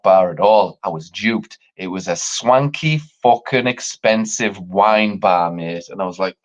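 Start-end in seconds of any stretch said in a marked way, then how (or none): none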